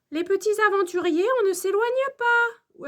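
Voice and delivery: high, quick, firm warning; avertissement de maîtresse. A narrator's reading. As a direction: warn the class not to wander. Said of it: "avertit la maîtresse". (mise en garde aiguë et pressée)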